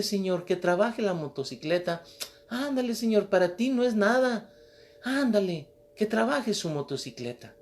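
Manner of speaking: high, wheedling little prayer; old woman praying. Acted. Old woman praying over the motorcycle, high and coaxing. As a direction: coax God like a familiar friend.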